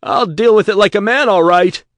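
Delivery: high, loud, desperate shout. Acted. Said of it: "Gravenstein shouted".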